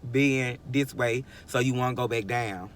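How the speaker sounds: usual speaking voice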